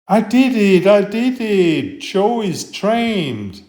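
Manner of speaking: put-on a boy bursting with pride over his backhoe; excited boy. Nolan shouting to Joe that he finally trained him, excited.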